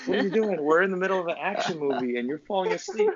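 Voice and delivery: high, incredulous ribbing of dad; teasing. Retold or imitated. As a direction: make fun of a dozing parent. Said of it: "I used to make fun of my, my dad".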